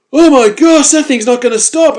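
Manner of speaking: loud, high-pitched cry of panic; alarmed shout. Retold or imitated. Phil seeing the shark fly up at him, alarmed shout. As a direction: blurt it out as danger races up.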